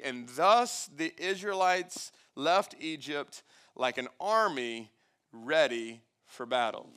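slow, deliberate Bible reading; scripture reading. Acted. Preacher finishes the passage for congregation, slow weighty scripture reading. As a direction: read it with weight, pausing between phrases.

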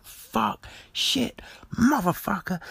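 high-pitched mock swearing fit; letting rip. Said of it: "like, letting rip".

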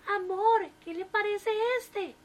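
put-on high questioning old wife; a wife sweetly asking her husband; wife's voice. A narrator's reading. Wife asks about motel.

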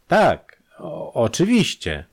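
nervous stammer, slowing on the second word. A narrator's reading. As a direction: stumble over the start of it. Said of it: "Sten zaczął się jąkać".

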